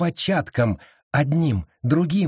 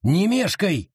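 higher urgent shout; sharp urgent cry, higher; yelling; 6 semitones higher than usual. A narrator's reading. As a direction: yell at her not to dawdle. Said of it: "заорал он".

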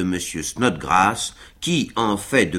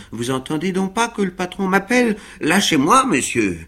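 impatient Sam; 9 semitones higher than usual. A narrator's reading. Sam pulling free from Winkle.

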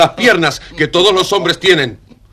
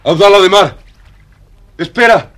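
louder, higher call after him; calling after his departing friend; pleading call; 4 semitones higher, 4 dB louder than usual. Acted. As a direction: beg him to stay. Friend leaving forever.

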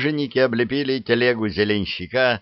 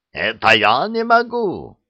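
indignant; 4 semitones higher, 4 dB louder than usual. Acted. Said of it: "возмутился Ара".

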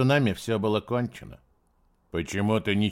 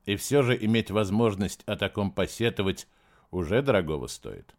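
ordinary delivery

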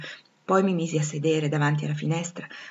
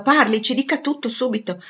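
raised, higher insistent voice; urgent demand; 6 semitones higher, 5 dB louder than usual. A narrator's reading. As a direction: press for an immediate answer. Miss Ingram demands an answer. (richiesta insistente e concitata)

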